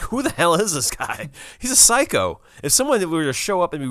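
loud, high-pitched disbelief; mock incredulous outburst. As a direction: burst out as if appalled by an impostor. Acts astonished reaction to the impostor.

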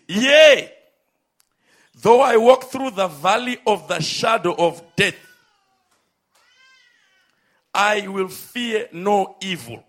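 loud, proclaiming the verse with force; proclaimed scripture. Acted. Preacher proclaiming the psalm to the congregation, loud and fervent. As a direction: declaim it like a preacher rising.

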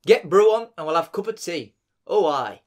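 hearty mock Yorkshire accent; northern English accent. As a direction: play up the Yorkshire dialect. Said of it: "It's definitely up north somewhere".

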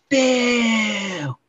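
high, put-on English lady calling Bill; mock English lady. Brother mimicking the English lady from Willow, high put-on English accent. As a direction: call out like a prim English lady. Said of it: "I remember she's like".

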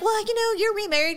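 high-pitched, lightly mocked interviewer; mimicked interviewer. Interviewer prompting an actress about her remarriage, lightly mimicked.

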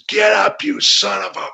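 taunting yell. Retold or imitated. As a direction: sneer it down at him. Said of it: "and I said".

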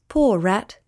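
ordinary delivery

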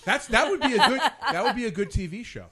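laughing at the thought of a mother-daughter TV show. Real, not acted. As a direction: chuckle it out at the joke. Laughs at mom joke.

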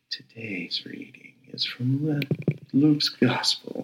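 very soft, hushed imitation of an audio reader; exaggeratedly soothing and quiet, like ASMR; soothing devotional voice. Imitating the prayer app's reader for a friend, soothing hush. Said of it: "the most soothing voice to listen to".

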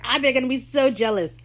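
hammed-up excited quote; mock gloating. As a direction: gush as if showing off.

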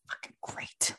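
unvoiced, under the breath; muttered curse. Abe cursing to himself alone in the woods, muttered whisper. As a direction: grumble it to yourself in a whisper. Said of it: "because he's muttering".

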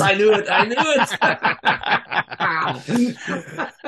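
high, loud "I knew it!"; triumphant yell at the roll; excited shout. Real, not acted. GM reacts to a roll.

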